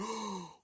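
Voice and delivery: short high intake of breath; imitated gasp of awe; mock crowd gasp. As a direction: gasp like shocked spectators. Imitating the watching crowd gasping.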